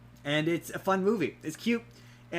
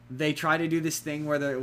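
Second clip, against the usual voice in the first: ordinary talk, nothing marked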